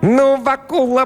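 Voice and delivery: very high, drawn-out squeak; squeaking devil. A narrator's reading. Devil perched on the blacksmith's neck, squeaking. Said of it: "пропищал чёрт".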